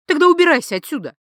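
loud, high, fast order to leave; angry dismissal. Acted. Woman orders unwelcome wife out, loud, fast and angry. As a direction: throw the visitor out sharply.

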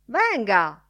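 shouted call. A narrator's reading. Professor to his companion, shouted call. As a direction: call out sharply to summon someone. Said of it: "gridò il professore".

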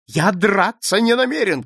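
high, loud protest; shouting. A narrator's reading. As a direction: cry out your refusal. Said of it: "крикнул он". (громкий протестующий крик)

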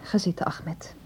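hushed whisper; urging a friend to sit, in a whisper. Acted. Secretive meeting, fears listeners.

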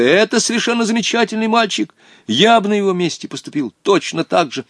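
high-pitched heated defence; flaring up. A narrator's reading. Boy defending the stubborn lad to his companion, heated. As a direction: leap hotly to someone's defence. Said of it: "вспыхнул Волька".

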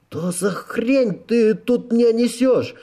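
high, slow indignant outburst. A narrator's reading. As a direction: protest in outrage, dragging out the words. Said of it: "возмутился офицер".

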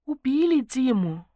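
high, slow, honeyed announcement; sugary sweet. A narrator's reading. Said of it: "сладким голосом продолжила".

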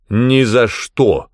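loud, drawn-out refusal; emphatic proclamation, stretched out; proclaimed refusal. A narrator's reading. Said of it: "провозгласил он".

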